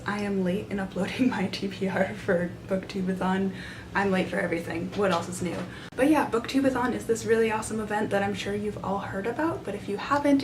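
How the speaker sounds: ordinary talk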